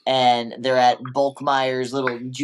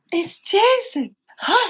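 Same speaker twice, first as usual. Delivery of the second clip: much higher excited squeal; kid-like excitement. Retold or imitated. Podcaster mimics Rangers spotting Jason, high excited squeal. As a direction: cry out like a kid on Christmas. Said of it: "like little kids on Christmas".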